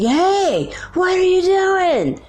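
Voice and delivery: high-pitched prisoner's cry of alarm; imitated scream. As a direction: call out in startled protest, acting it out. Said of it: "heard one of the prisoners scream out".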